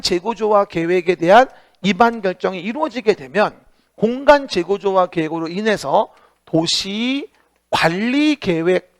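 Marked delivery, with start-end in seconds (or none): 6.4-9.0 words spaced out slowly for note-taking; slow dictation. Shown as a demonstration. Teacher slowly dictating a term for students to write. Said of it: "써요".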